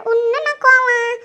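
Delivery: loud, high, drawn-out incredulous question; indignant disbelief. Acted. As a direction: question it loudly, stretching the words in disbelief. In-law reacts to husband's lasting anger.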